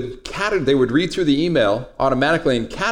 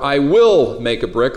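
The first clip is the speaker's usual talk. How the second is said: "will" punched louder and drawn out; stress on WILL; 3 dB louder, slower than usual. Shown as a demonstration. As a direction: hit the word "will" hard. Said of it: "where you put the emphasis".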